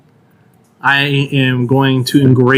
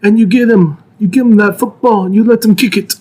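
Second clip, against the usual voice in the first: threatening mob boss; Italian American mobster voice; 5 semitones higher than usual. Pretend. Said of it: "the blend of Italian American and Christopher Walken".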